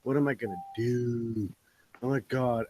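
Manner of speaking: low, slow moping whine, hammed-up misery; mock crying. Mocking a brewer who lost his storage bid, mock crying. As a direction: imitate a man moaning softly in self-pity. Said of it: "He was crying in the fucking corner".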